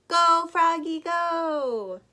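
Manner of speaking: loud, high, drawn-out cheer; long high rooting yell; cheering shout. A narrator's reading. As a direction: shout them on like a crowd. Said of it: "Froggy's friends shouted".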